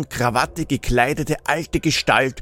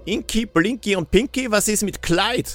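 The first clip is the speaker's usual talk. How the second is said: cheeky interruption, pitched up; teasing; 8 semitones higher than usual. A narrator's reading. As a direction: butt in with a mocking question. Grouch interrupts with a joke.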